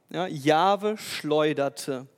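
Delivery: "Jahwe schleuderte" slowly, every word weighted; slow emphatic repeat. Acted. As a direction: lean hard on "Jahwe schleuderte". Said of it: "Jahwe schleuderte einen gewaltigen Sturm". ("Jahwe schleuderte" langsam und betont)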